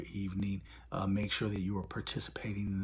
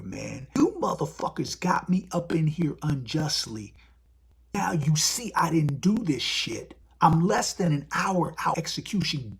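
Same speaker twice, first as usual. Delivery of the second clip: angry inmate; 6 semitones higher, 6 dB louder than usual. Retold or imitated. Death row inmate demanding release.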